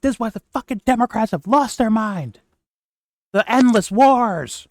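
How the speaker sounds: high, loud raging impression; mock raging. Host imitating the commentator's old rage at Democrats, mock raging. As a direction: imitate a furious political rant at full pitch. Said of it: "he was raging before".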